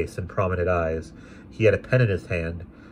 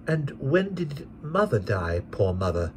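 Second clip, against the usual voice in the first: tender; 7 semitones higher than usual. A narrator's reading. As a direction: ask gently and slowly. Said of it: "my guardian".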